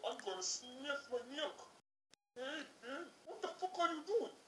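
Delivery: ordinary talk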